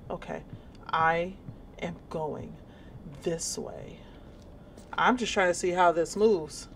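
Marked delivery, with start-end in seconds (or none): none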